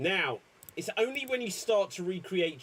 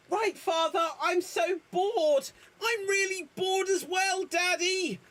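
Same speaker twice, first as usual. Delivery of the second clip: put-on very high whiny child voices; squeaky complaining kid; bored kids; 13 semitones higher than usual. Playing the Chitty children.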